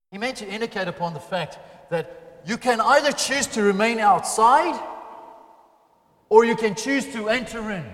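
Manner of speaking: loud, high, rising sermon voice; fervent preaching. Acted. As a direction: preach it out to the whole hall. Preaching at full force.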